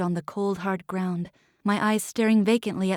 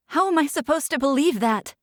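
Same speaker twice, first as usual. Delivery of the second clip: shouting; 6 semitones higher, 3 dB louder, faster than usual. A narrator's reading. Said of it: "I shouted over Isko".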